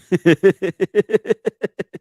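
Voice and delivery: loud, high cackle; high burst of laughter. Real, not acted. Streamer watching Smack's face, high loud cackle. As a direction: break into a big laugh.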